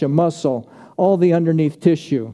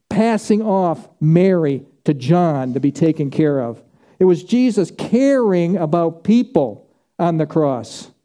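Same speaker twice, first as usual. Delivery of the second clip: ordinary delivery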